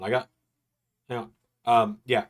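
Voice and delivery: ordinary talk, nothing marked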